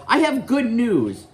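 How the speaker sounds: high, slow announcement; McGonagall announcing. Retold or imitated. Professor coming in with news for the kids, high announcing voice. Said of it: "she comes in and she says".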